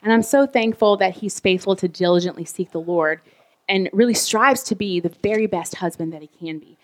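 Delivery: normal talking voice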